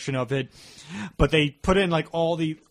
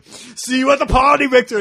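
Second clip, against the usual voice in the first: Arnold line; 9 semitones higher, 9 dB louder than usual. Retold or imitated. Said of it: "He's like".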